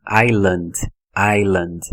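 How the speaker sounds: "island" said EYE-land with a silent s; correct English "island". Shown as a demonstration. Teacher modelling "island" with no s sound for students. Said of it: "ela tá certinha com a pronúncia".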